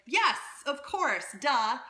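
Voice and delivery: emphatic, as if it were blindingly obvious; obvious, duh. Retold or imitated. Performer who already knows all this, mock exasperated certainty. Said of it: "you're like".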